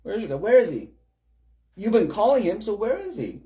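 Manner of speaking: high, loud mocking jeer; mocking taunt. Elijah taunting the prophets, high mocking jeer. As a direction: taunt them like a smug prophet. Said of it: "even Elijah mocks them".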